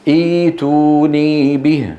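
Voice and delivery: إيتوني به slowly with long held vowels; madd al-badal. Shown as a demonstration. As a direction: recite إيتوني به drawn out, no stop after the first ee. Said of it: "أصلها إيش؟". (تلاوة «إيتوني به» بمدّ الياء)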